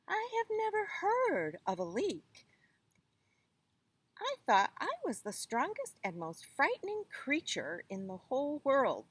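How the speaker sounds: a hint of a proud tiger bragging. A narrator's reading. Tiger voice in read story.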